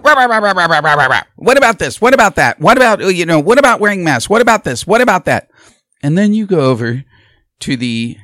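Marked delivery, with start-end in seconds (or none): 0.0-5.5 loud, higher, relentless hectoring; mock badgering debater. Mocking the moderator badgering Trump, loud hectoring. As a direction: hammer him with question after question. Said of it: "It was just like".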